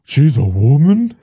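gasping, stunned question; shocked gasp. Retold or imitated. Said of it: "everybody else is like".

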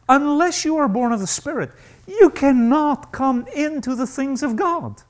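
unless hit hard each time; rising, hammering preaching; stressed unless. Pretend. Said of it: "that's a conditional phrase".

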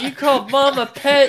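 high, loud disbelieving shout; outraged Mark. Retold or imitated. Mark confronting his dad about Mom, high outraged shout. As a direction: fling it back at him. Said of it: "he's like".

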